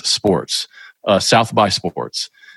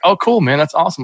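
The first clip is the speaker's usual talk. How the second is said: higher-pitched retold enthusiasm; mildly excited; 7 semitones higher, faster than usual. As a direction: lift the pitch, lightly imitating a pleased reply. Retelling his friendly reply to a fan.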